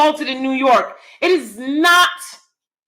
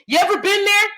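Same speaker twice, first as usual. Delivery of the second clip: normal talking voice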